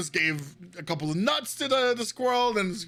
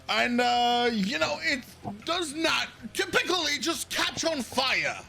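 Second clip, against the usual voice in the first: high-pitched, rambling complaint from a fussy noble; pompous noble rant; 6 semitones higher, slower than usual. Acted. Noble complaining to the guard about his caravan, in a high fussy voice. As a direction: whine about your ruined caravan.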